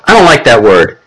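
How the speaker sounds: usual speaking voice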